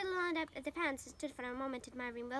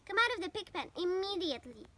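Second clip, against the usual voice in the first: higher, louder scolding call; crying out; 6 semitones higher, 5 dB louder than usual. A narrator's reading. Mother scolds Avery, loud higher call. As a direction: scold him out of the pen. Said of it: "cried Mrs. Arable".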